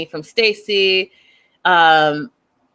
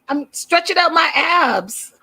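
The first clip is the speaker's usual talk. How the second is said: mock protest; 8 semitones higher than usual. Mimics Darcy's euphemism, mocking.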